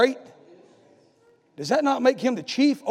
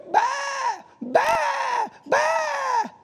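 sheep bleat; 13 semitones higher, 3 dB quieter, much slower than usual. Retold or imitated. Preacher imitating a sheep on its back, high bleat. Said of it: "It'd go".

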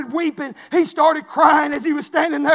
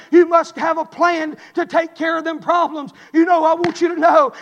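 ordinary talk

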